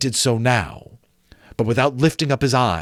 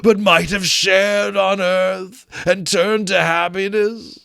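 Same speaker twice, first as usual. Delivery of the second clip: higher, slow wailing moan that swells loud; ghost lamenting; 8 semitones higher, 3 dB louder than usual. Acted. Marley's ghost explaining his doom, slow wailing ghost voice. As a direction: mourn it like a doomed spirit.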